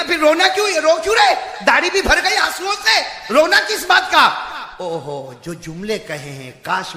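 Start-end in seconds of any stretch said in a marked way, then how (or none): 0.0-4.3 high, loud preacher's retelling of her plea; acted anguished questioning, building to a shout; impassioned pleading daughter. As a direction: cry out her questions to her father.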